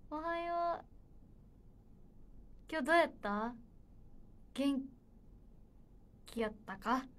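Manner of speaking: lightly imitated Kansai-speaking colleague; Iokki impression. Idol channels a fellow member on request, tentative Kansai-accented imitation. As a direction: have a go at a colleague's Kansai greeting. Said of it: "いおきを降臨させてね".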